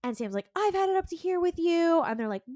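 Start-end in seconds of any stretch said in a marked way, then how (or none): 0.6-2.0 high, loud, fed-up snap; hammed-up fed-up sibling; exasperated outburst. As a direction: blow up at him in a pitched-up voice. Sam fed up in fight.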